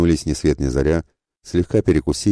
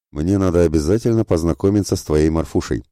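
ordinary delivery, nothing marked

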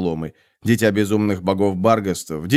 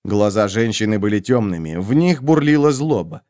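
ordinary delivery

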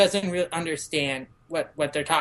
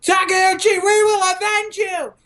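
much higher, louder heroic vow; vengeful battle cry; 13 semitones higher, 6 dB louder than usual. Retold or imitated. Shinsengumi vowing revenge for Takeuchi, heroic shout. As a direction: swear revenge to the heavens. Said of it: "And they're saying".

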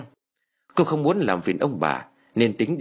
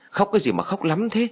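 much higher, exasperated mild yell; loud scolding; 10 semitones higher than usual. A narrator's reading. Grandmother at the crying child, high-pitched scolding. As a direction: push the pitch up crossly, not the volume. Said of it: "bà quát to".

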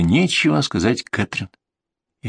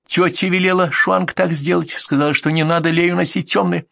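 put-on child's voice, pitched higher; маленькая девочка; 6 semitones higher than usual. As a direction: answer innocently in a small girl's higher voice. Little girl answers the adults. (детским голоском, повыше)

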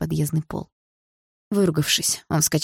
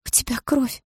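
high, hushed alarm; shocked whisper; 8 semitones higher than usual. A narrator's reading. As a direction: gasp the words at seeing blood. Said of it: "прошептала мама". (испуганный полушёпот)

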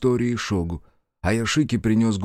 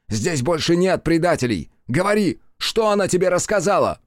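furious demand; 6 semitones higher than usual. Acted. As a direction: force the answer out. Threatening Foe.

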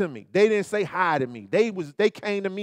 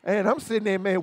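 ordinary talk, nothing marked

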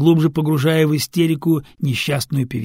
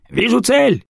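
shout; 9 semitones higher, 5 dB louder, slower than usual. Acted. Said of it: "кричит рулевой".

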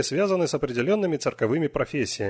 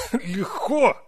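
much higher incredulous echo; 11 semitones higher than usual. Acted. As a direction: echo the word in disbelief. Weir questions between coughs.

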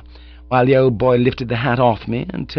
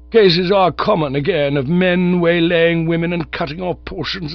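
put-on higher, stiff voice of a pompous old nerve specialist; pompous old gentleman; 5 semitones higher than usual. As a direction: lecture like a self-important old doctor. Sir Roderick's long speech.

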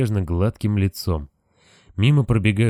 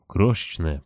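ordinary delivery

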